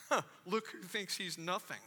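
low, soft, dry put-down; scornful aside. Acted. Head monk to number two monk, scornful aside. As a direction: mutter the punchline under your breath.

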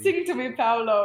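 hammed-up teen-movie Italian accent; bad Italian accent. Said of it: "The only Italian accent I know".

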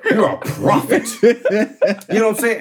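high, giggling sarcastic praise; laughing mock praise. Mocking the gamer Skylar, laughing. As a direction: laugh through the fake compliment.

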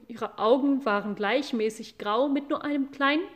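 ordinary delivery

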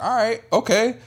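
high, surprised and impressed; impressed fan voice. Retold or imitated. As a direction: squeak out grudging respect.

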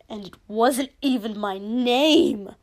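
loud, indignant protest; venomous outburst. Acted. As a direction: let the grievance burst out, swelling to the end.

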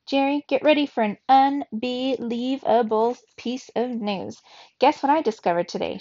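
hint of an excited sister squeak; squeaky little sister. A narrator's reading. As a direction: tease him with big news in a light squeak. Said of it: "she squeaked".

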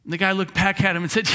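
usual speaking voice

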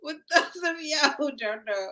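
words breaking up with real laughter; laughing through it. Real, not acted. Host restarting the intro while cracking up, laughing through it. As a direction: try to get the line out while cracking up.

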